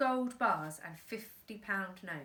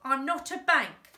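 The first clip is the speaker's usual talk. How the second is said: louder snappy retort; snapped; 4 semitones higher, 7 dB louder than usual. Acted. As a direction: snap it back. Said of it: "snapped Mister Big".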